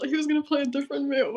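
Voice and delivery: ordinary delivery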